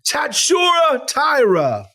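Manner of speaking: high, drawn-out mock announcer shout; over-the-top, stretched-out cry; exaggerated name call. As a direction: belt the name out slowly. Redoes botched name, shouted exaggeratedly.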